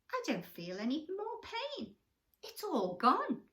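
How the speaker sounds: relieved crocodile. Acted. Crocodile tells the mouse his pain is gone, delighted. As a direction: marvel that the pain has gone. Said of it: "the crocodile sat up and said".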